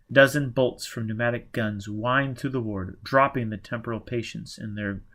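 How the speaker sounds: ordinary delivery, nothing marked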